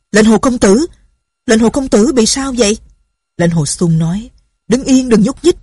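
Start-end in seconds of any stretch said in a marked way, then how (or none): 0.1-2.8 loud, higher anxious call; worried shout. Acted. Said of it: "Tổ Thiên Thu la lên". 4.7-5.6 urgent command. Acted. Warning them not to move.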